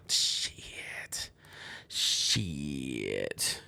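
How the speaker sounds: hushed whispered curse; whispered shit. Real, not acted. Excited about the concert, whispered low curse. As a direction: breathe it out low.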